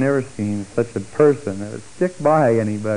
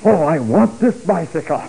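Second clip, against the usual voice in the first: excited pleading; 6 semitones higher, 5 dB louder than usual. Retold or imitated. Wife begs for the bike.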